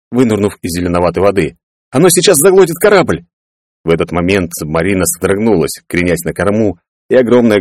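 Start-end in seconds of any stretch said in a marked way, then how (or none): 1.9-3.2 high-pitched warning shout; urgent calling. A narrator's reading. Clarence warns the ship will be swallowed, urgent shout. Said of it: "позвал снизу Кларенс".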